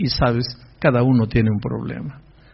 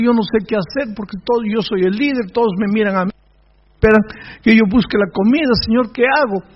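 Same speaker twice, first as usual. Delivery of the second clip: higher-pitched, mildly acted desperate prayer; tearful plea; 7 semitones higher than usual. Preacher voicing Moses begging God for food, mock-tearful plea. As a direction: plead like an overwhelmed leader, without real sobs. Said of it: "se arrodilló llorando y le dice".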